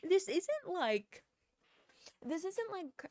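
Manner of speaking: loud and high, incredulous; nearly shouting in disbelief; exasperated outburst. Real, not acted. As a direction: voice jumping up in outraged disbelief. Outraged rant about the manager.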